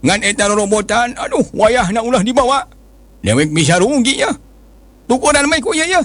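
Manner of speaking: put-on high, begging old man; pleading old man. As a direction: beg for mercy, flustered.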